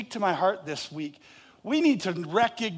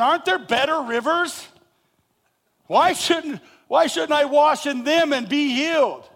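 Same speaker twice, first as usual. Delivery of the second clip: higher, whiny protest; indignant complaint; 6 semitones higher, 4 dB louder than usual. Retold or imitated. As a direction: voice Naaman huffing about rivers. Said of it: "Then Naaman goes on".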